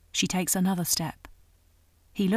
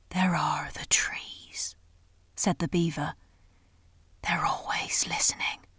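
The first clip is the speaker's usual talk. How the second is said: hoarse throaty whisper; 2 semitones lower, 3 dB quieter than usual. A narrator's reading. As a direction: hush it as if the trees could hear. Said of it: "in a hoarse, throaty whisper".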